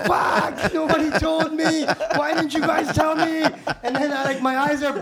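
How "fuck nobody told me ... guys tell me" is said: higher, louder, laughing through it; laughing mock protest. Rookie protesting nobody warned him, laughing loud. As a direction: complain while cracking up. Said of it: "And I'm like".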